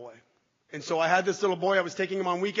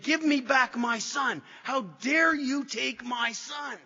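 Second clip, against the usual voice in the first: slow anguished yell, higher and louder; 7 semitones higher, 4 dB louder, slower than usual. Retold or imitated. Father in police car yelling for his taken son, anguished slow yell. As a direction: drag out a desperate shout. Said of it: "I was yelling these words".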